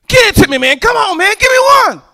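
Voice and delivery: high, loud, desperate begging shout; pleading shout. Retold or imitated. Street men shouting at the preacher for a tract, high begging shout. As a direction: yell it like you can't wait any longer.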